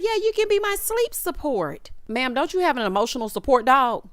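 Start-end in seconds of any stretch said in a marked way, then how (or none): 0.0-1.9 mock girlish.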